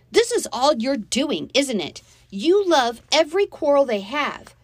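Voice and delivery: high, loud accusation; angry yelling. A narrator's reading. Girl blaming stepsister for the parents' quarrel, yelling angrily. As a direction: lash out at full voice. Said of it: "I yelled at her".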